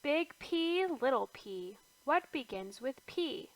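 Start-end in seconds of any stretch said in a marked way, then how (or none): none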